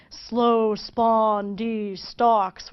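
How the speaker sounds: "slow spondee stalks" very slow, every syllable stressed; spondee line. Shown as a demonstration. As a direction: drag out each heavy beat evenly. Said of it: "spondee is two stressed syllables".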